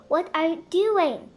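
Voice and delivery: loud alarmed question. Acted. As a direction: demand it urgently.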